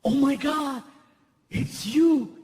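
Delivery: starstruck officer. Retold or imitated. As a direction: gush like you just met a celebrity. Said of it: "He goes".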